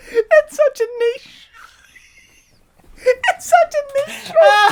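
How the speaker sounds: high, loud, squealing through laughter; helpless laughter. Real, not acted. Cracking up with a friend over a niche joke, helpless high laughter. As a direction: barely get the words out, cracking up, slow and squeaky.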